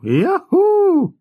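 high, loud yahoo holler; all-out whoop. A narrator's reading. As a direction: whoop it at full lung power. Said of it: "Щодуху крикнув".

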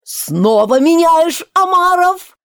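high, loud, slow bellow; yelling at full voice. A narrator's reading. Gryphon bellows the dance figure with all his voice. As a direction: yell at the top of your lungs. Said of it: "вопил во весь голос Грифон".

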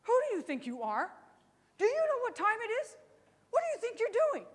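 scolding mom. Retold or imitated. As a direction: play the angry mom at the door. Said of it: "she goes".

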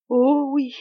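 sighed. A narrator's reading. Commandante sighing over the handsome captain, longing sigh. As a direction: breathe it out with a wistful sigh. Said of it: "soupira la commandante".

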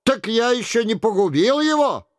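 high, quick, astonished question; alarmed disbelief in the mock king's voice; startled cry. A narrator's reading. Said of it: "вскричал Шико".

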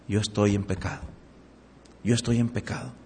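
softly admitting sin to himself; quiet inner confession. Retold or imitated. Churchgoer realising his sin, quietly to himself. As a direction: murmur it like a private realisation. Convicted listener speaking inwardly.

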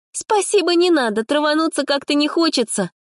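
put-on very high, scornful girl's voice; high sniffy refusal; haughty refusal. A narrator's reading. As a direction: turn it down with disdain. Proud girl refuses drink.

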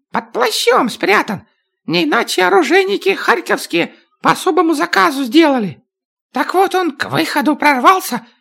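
high, fast-talking little dwarf; lively dwarf voice, pitched high; dwarf's squeaky voice. Acted. Dwarf Chak keeps talking.

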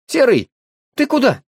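high, loud, lost call; bewildered call. A narrator's reading. Boy calls the dog as it runs away, bewildered. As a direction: call out, confused and worried. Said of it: "растерялся Женька".

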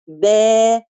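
مد با بحركتين; long ba. Shown as a demonstration. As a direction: stretch the vowel of با over two beats. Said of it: "أقول با". (با بمد حركتين)

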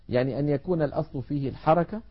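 normal talking voice

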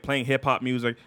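ordinary delivery

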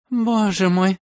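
high moaning cry of horror; horrified moan. A narrator's reading. Woman behind the door moaning in horror at police. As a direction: moan it in dread. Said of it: "с ужасом простонали за дверью".

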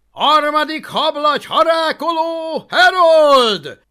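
very loud, high roar; bellowed announcement. A narrator's reading. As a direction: roar the name across the arena. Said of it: "ordította Alvin".

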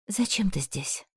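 soft whispered question. A narrator's reading. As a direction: whisper it, barely audible. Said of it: "прошептала я".

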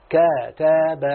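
كتب drawn out slowly, syllable by syllable; slow demo reading. Shown as a demonstration. Teacher drawing out كتب slowly for the student. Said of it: "اسمع كده".

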